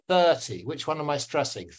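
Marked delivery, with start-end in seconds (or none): 0.1-0.6 thirty with a strong THIR, light falling ty; THIR-ty, crisp teacher demo; stress on first syllable. Shown as a demonstration. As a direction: punch the first half of "thirty". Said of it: "The first syllable".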